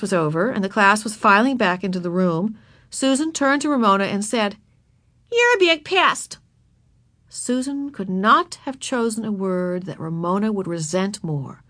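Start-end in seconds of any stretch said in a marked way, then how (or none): 5.3-6.4 put-on high, slow taunting girl; snotty little girl. A narrator's reading. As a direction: sneer it like a bossy child. Susan insults Ramona.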